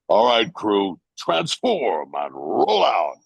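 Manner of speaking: slow, commanding robot leader voice; signature Transformer line. Shown as a demonstration. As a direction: give the order like a heroic robot commander. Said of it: "say your signature Transformer line".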